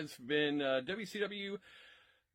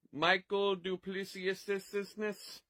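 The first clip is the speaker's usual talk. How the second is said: ordinary delivery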